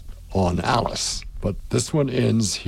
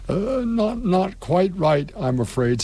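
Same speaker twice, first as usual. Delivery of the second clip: timidly; 8 semitones higher, slower than usual. A narrator's reading. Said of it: "said Alice timidly".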